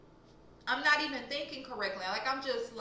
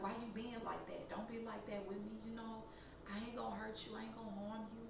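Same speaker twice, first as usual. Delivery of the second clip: imitated coaxing; 12 dB quieter than usual. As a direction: mimic a creep murmuring that he's harmless. Imitates stranger coaxing her.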